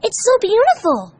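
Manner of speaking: high, loud, amazed; overwhelmed with joy; high, excited gasp of wonder; gasping delight. Acted. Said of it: "he gasped".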